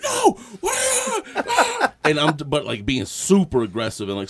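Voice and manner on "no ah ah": very high, loud imitated screams; mock yelling. Guard yelling at a passing car, mock screaming. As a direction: scream it like an outraged traffic guard. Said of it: "the dude is yelling like".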